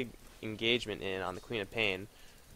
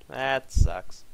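"That sucks" said out loud, a bit louder and stronger; louder complaint; 6 dB louder than usual. Real, not acted. Player let down by the play, complaining "That sucks" aloud. Repeated louder after whisper.

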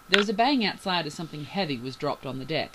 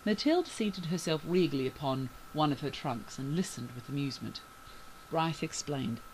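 ordinary delivery

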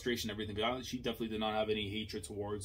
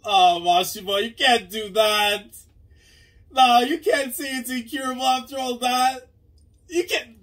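much higher and louder, pleading cry; real distress at the reveal; anguished protest; 13 semitones higher, 13 dB louder than usual. Real, not acted. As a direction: beg the character not to. Distraught reaction to incurable illness.

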